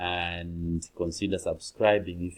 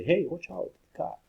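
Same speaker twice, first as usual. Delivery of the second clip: high hey flung out at someone; sudden call of danger; urgent warning; 10 semitones higher than usual. Shown as a demonstration. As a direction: warn of a car coming. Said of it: "a car coming towards someone".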